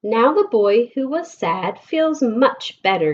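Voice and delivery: ordinary talk, nothing marked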